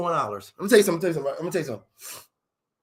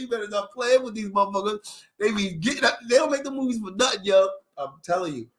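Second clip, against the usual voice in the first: ordinary delivery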